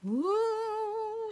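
high delighted ooh; excited ooh. Real, not acted. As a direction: gush over the outfit. Showing off jacket, gushing.

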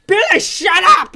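real playful yell. Real, not acted. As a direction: shout him down with a laugh. Yelling at game enemy.

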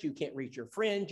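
normal talking voice

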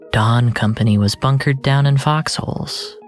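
plain reading voice

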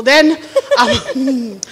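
ordinary delivery

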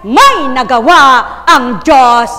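loud, punched-out phrases with pauses; emphatic shout from the pulpit; shouted preaching. Real, not acted. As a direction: preach it at full volume, phrase by phrase. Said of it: "ba't, [name], sumisigaw ka pa".

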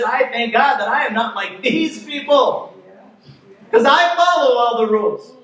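lightly voiced proud religious man showing off; smug Pharisee. Smug Pharisee boasting in temple.

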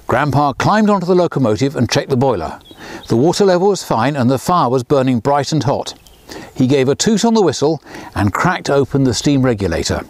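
plain reading voice

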